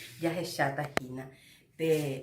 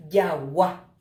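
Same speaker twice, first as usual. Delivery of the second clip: Yawa with the last A hit louder; stress on final A; 2 semitones lower, 7 dB louder than usual. Shown as a demonstration. Teacher shows the class a tonic vowel, final A of Yawa stressed. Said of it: "con mayor fuerza".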